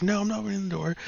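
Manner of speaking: high, frightened woman voice; scared woman's refusal. Retold or imitated. Girl refuses to open door.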